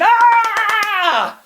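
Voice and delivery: very high shouted howl of excitement; excited howl. Acted. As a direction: howl with the crowd at the kill. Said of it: "We all join in with howls of excitement".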